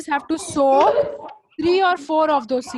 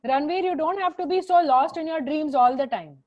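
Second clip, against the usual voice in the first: ordinary talk, nothing marked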